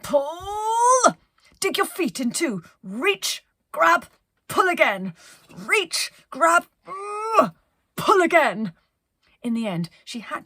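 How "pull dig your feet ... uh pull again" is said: high, loud calling out with strain; captain yelling orders while climbing; shouted instructions. Acted. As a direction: call out each move as you heave. Penelope coaching the climb.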